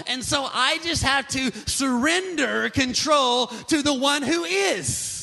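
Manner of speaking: full-force preaching. Real, not acted. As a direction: drive it home with stretched, emphatic words.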